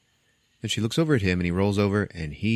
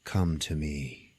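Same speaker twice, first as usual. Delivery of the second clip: low, slow and soft summons; softly voiced mummy luring her victim; beckoning mummy; 5 semitones lower, 6 dB quieter, much slower than usual. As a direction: beckon softly, drawing out each word.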